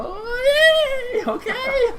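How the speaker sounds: very high, thin crowd yay; half-hearted crowd cheer. Acted. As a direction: imitate an unsure crowd cheering. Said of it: "And everyone's kinda like".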